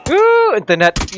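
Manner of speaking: high, loud frustrated cry; frustrated yell. Real, not acted. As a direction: yell it at the lag. Gamer yelling at bad internet.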